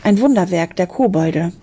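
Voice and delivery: plain reading voice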